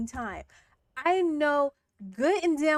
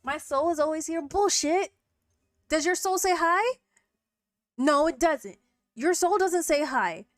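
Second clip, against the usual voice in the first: mocking scold; 4 semitones higher, 4 dB louder than usual. Real, not acted. Mocking chatter's excuse.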